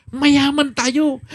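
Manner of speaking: slow, high and loud; emphatic again. Acted. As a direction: stretch each word. King repeats to son. (mabagal, mataas at madiin)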